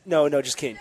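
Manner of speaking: usual speaking voice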